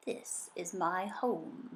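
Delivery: put-on a gentle old fish welcoming a guest; low soothing fish voice. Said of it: "His voice was so low and soothing".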